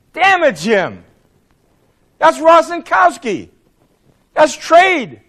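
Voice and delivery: high, slow exasperated protest; exasperated objection. Retold or imitated. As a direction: push back high and loud, as in a retold argument. Retold heated objection from a colleague.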